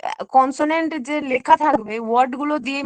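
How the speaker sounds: ordinary talk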